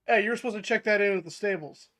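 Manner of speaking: guard. Acted. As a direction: challenge them like an officious town guard. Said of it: "A guard comes over to you".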